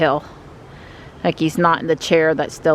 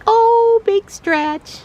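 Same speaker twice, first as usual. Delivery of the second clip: high, louder cooing; pet voice; 3 dB louder, much slower than usual. Real, not acted. Watching the cat stretch, high gushing coo. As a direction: gush over the cat's stretch.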